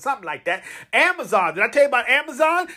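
ordinary delivery

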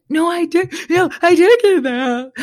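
high, tearful protest, half sobbing; high pitched teary insistence; retold ugly crying. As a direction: re-enact crying while insisting. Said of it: "I'm, like, ugly crying".

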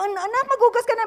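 put-on high, loud call to a child; mock parent command. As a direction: order the kid to wash up. (ginagayang utos sa anak)

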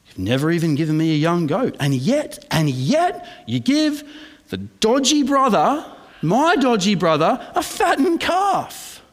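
high, indignant sibling whine; mock the jealous brother's sputtering outrage; jealous son griping at his father, high whine; whiny older son. Son voiced whining at father.

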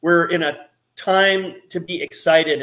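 usual speaking voice

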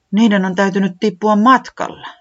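can't believe it, pitch climbing; disbelieving säämies. Acted. Säämies staring at the empty bag, high and dismayed.

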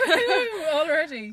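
high, loud woohoo; excited cheer. Real, not acted. Cheering about the guest.

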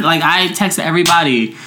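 normal talking voice

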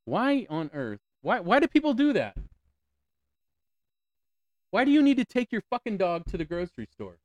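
furious at people bringing dogs to the grocery store; exasperated rant. Real, not acted. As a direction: build to an incredulous rant. Ranting about dogs in stores.

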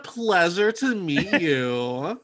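slow, polished customer service voice; overly polite shop clerk voice. Shown as a demonstration. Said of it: "my customer service voice".

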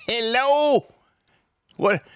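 high mocking Hello as if knocking on a head; mock-incredulous hello; sarcastic hello. Real, not acted. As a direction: wake them up with a sneer. Sarcastic wake-up call.

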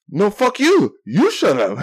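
high, loud mock retort; retold yelled comeback. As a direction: imitate a street yeller firing back. Acted shouting match with street yeller.

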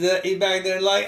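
normal talking voice

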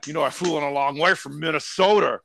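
blustery wrestler-governor guest voice; Jesse the Body Ventura. Pretend. Special guest greeting the podcast hosts, blustery wrestler impression. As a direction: arrive as a puffed-up wrestling personality. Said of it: "all-American Jesse the Body".

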